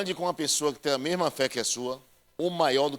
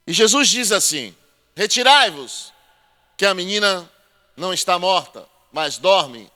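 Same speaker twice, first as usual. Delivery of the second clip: loud, raised preaching voice, building; full-force preaching; 8 dB louder than usual. Acted. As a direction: preach it out to the whole church. Preaching Bible story loudly.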